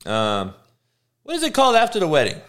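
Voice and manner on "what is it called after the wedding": high, fast, frustrated question; asking again, higher and faster; exasperated. Real, not acted. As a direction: fire it off high and quick.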